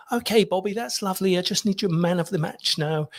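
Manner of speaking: high, light English woman's voice; English copy taker. Retold or imitated. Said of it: "it was an English woman".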